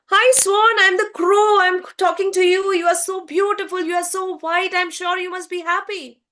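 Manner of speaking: high, quick gush of admiration; excited crow. A narrator's reading. Crow greets the swan it admires, excited and high. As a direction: gush eagerly in a high, fast voice. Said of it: "he's so excited".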